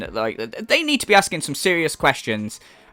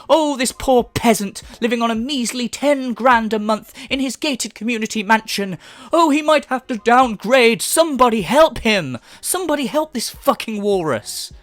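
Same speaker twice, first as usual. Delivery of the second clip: hammed-up, higher-pitched lament; mock pity; 5 semitones higher than usual. Commentator pretending to beg help for a rich man, mock pity. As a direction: plead as if for a tragic victim.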